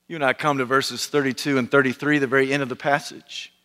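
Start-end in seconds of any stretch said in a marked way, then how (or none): none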